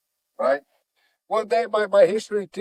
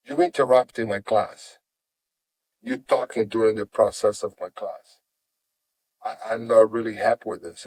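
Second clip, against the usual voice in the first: low, controlled reprimand; displeased teacher; 7 semitones lower than usual. Retold or imitated. Professor confronting the student who talked in class, low stern teacher voice. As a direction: imitate a stern professor, pitched well down. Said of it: "He goes".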